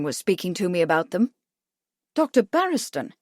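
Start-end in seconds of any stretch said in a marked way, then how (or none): 2.1-3.1 higher-pitched startled query; exclaimed. A narrator's reading. Charles reacting to mention of Doctor Barriston, exclaiming in surprise. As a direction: exclaim the name as a question. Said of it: "Charles exclaimed".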